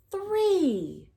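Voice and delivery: ordinary delivery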